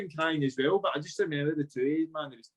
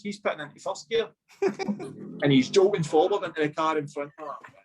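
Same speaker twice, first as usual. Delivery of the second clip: ordinary delivery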